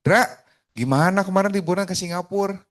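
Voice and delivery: high, eager question about the trip; lively personal opener. Shown as a demonstration. Coach asking a client about a Singapore holiday, lively and eager. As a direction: jump straight in with keen interest. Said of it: "gue akan nanya gini".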